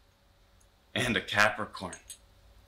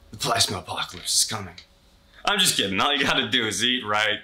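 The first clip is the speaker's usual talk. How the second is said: ordinary delivery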